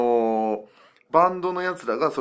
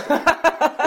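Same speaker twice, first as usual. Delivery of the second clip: loud laugh; 13 semitones higher, 7 dB louder than usual. Real, not acted. Laughing at being called real.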